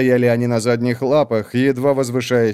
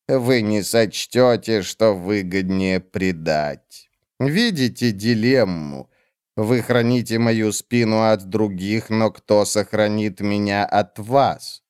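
cold witch; 2 semitones lower, slower than usual. Acted. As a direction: drag each word out, icy and dismissive. Olar Ethil slow low.

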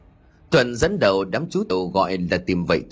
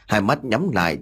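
plain reading voice

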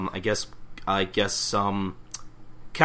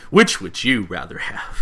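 slow, louder pitchman read; commercial voice; 7 dB louder than usual. Shown as a demonstration. Co-host shows how the ad line should sound, slow pitchman voice. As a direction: sell it like a TV commercial. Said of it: "make it sound like a commercial".

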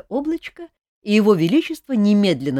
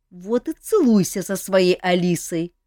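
angry snap; 6 semitones higher, 5 dB louder than usual. A narrator's reading. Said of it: "зло бросила Эльвира".

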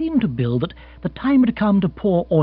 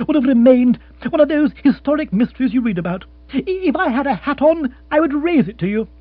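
excited; 12 semitones higher, 7 dB louder than usual. Acted. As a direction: cry out in triumph. Lord praising.

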